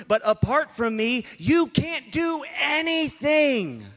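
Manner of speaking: slow sermon voice building to a shout; preaching. Acted. Preacher voicing God to the congregation, building to a shout. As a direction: preach it slowly, rising to full force.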